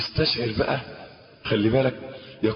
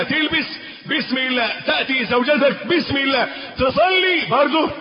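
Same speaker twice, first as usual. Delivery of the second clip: building preaching; 10 semitones higher than usual. Acted. Preacher builds through the list.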